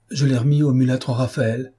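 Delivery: usual speaking voice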